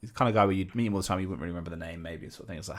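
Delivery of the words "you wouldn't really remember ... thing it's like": normal talking voice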